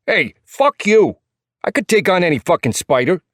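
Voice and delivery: high, loud New York voice full of attitude; cocky New York retort, pitched high; thick New York accent. A narrator's reading. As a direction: fire back as a feisty little New Yorker. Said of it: "His New York accent is thick and full of attitude".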